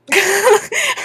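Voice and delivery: high burst of laughter; loud delighted laugh. Real, not acted. Laughing as grandpa asks to come along, high and loud. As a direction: break into a loud happy laugh.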